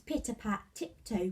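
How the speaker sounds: usual speaking voice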